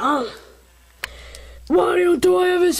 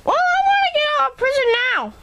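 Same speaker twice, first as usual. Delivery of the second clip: high, fast squeaky prisoner; 12 semitones higher, much faster than usual. Acted. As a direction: squeak it out quickly.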